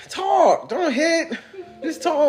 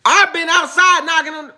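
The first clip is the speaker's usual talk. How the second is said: raised, higher voice at the door; 7 semitones higher, 6 dB louder than usual. Acted. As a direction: call it through the door, raised. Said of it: "I'm raising my voice again".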